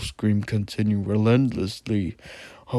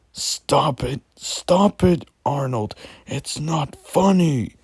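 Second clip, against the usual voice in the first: higher, louder frightened outburst; shouted; 6 semitones higher, 4 dB louder than usual. Acted. Narrator yelling at Arnold to stop the game, scared shout. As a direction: blurt it out, scared and pleading. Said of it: "I shouted".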